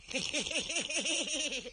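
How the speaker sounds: high giggling cackle from behind a screen; cackling laugh. Acted. Hidden Zhao Bi laughs at Guan Tai from behind the screen, high cackle. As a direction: cackle slyly, pleased with yourself. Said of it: "有人咯咯一笑".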